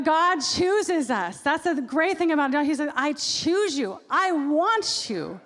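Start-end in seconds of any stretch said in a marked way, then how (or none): 3.0-5.4 slow, lifted tender declaration; a hint of a father's voice reassuring his child; tender promise. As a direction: draw out each word like a loving vow. Voicing God's tender promise.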